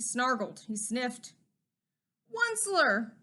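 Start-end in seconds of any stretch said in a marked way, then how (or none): 2.3-3.1 high croaking call of a name; croaky cry. A narrator's reading. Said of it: "he cried with a crofulous croak".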